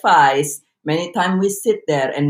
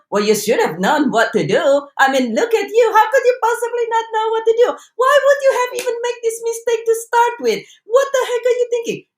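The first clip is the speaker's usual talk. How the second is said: much higher, fast, incredulous scolding; rapid-fire, higher, exasperated tirade; shrill berating critic; faster than usual. Retold or imitated. Harsh inner voice scolding yourself, much higher and fast. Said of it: "Would you say to your friend".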